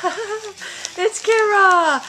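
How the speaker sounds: high, loud happy call; light up calling her name; excited greeting. Real, not acted. Greets daughter entering car.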